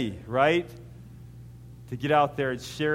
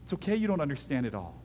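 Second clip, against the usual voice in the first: ordinary delivery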